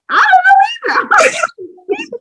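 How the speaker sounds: very high, drawn-out helpless squeal; helpless mock wail. New mom answering her panicked helper, helpless mock wail. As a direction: wail it slowly, at a comic loss. Two helpless women with crying baby.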